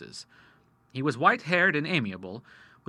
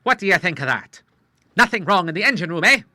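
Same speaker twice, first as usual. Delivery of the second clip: quick, louder and proud; pleased boast; 5 semitones higher, 8 dB louder than usual. A narrator's reading. The Admiral boasting to Charles, quick and proud. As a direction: show off your good heart.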